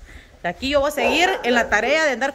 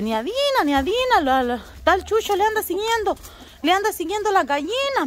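neighbors' warning; 11 semitones higher than usual. Retold or imitated. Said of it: "me dicen".

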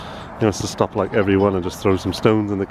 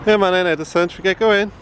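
coaxing call; 8 semitones higher than usual. Real, not acted. Calling toddler Edison along.

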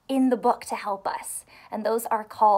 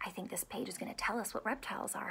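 whisper; 3 semitones lower, 14 dB quieter, much faster than usual. Acted. Teacher stage-whispers to class.

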